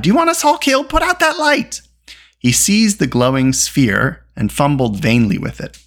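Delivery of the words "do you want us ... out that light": high, urgent order; panicked high-pitched telling-off; alarmed cry. A narrator's reading. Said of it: "he cried".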